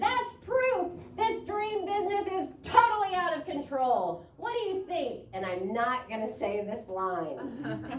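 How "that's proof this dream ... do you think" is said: high, cartoonish voice much higher than his own; shrieking voice. A narrator's reading. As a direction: keep the manic shriek going. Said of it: "screamed a voice out of nowhere".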